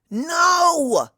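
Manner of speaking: loud, high shouted no; cry of no. A narrator's reading. Rainbow Dash silencing the gossip, loud high cry. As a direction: cut them off with a yell. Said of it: "cries Rainbow Dash".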